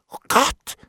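high yelp of shock; horrified exclamation. A narrator's reading. Bailiff hears his crime named, horrified. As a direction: cry out in fright.

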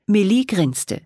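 plain reading voice

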